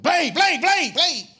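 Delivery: calling out. Retold or imitated. Comedian calling after Blade while chasing him with food. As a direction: yell down the street to stop him.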